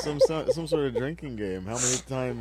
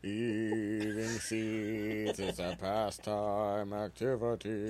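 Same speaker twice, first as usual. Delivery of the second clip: slow; 6 semitones lower, 7 dB quieter, much slower than usual. Pretend. Slow odd delivery, quoting.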